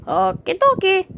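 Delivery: high bright Mario catchphrase; Mario impression. Mario's cheerful catchphrase to viewers, high and chirpy.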